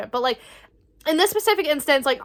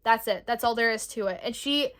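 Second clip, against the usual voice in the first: ordinary delivery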